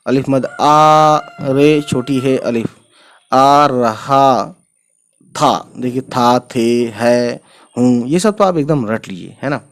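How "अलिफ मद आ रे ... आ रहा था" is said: very slow, stretched-out spelling aloud; slow teacher's read-aloud, letters then word. Shown as a demonstration. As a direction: draw out each syllable for beginners. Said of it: "मैं इतना slow इसलिए पढ़ रहा हूँ".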